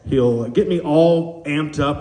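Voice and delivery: ordinary delivery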